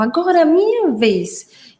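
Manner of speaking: high, drawn-out child's line, imitated; child's echolalia intonation. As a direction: mimic a little girl parroting a cartoon line with its tune. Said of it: "E ela fazia com a entonação". (imitando a entonação cantada da criança)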